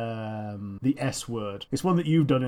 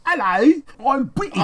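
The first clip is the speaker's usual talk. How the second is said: high, loud mock posh English voice; a hammed-up posh British accent; 12 semitones higher, 6 dB louder than usual. Friend mocking how Callie speaks British, hammed-up posh British accent. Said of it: "when Callie tries to speak British".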